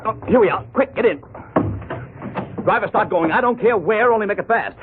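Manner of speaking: high, hurried urging of the driver; urgent, breathless haste. Acted. Fleeing thugs, he urges the taxi driver on, high and hurried. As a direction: rush the cabbie, pitch up with alarm.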